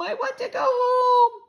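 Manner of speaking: high, loud, slow crying wail; little guest sobbing to be taken home. A narrator's reading. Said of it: "Sam cried".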